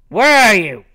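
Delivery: loud call into the trees, voice up high; loud searching call. Real, not acted. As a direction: shout to find her. Calling out for hidden opponent.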